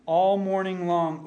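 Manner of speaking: usual speaking voice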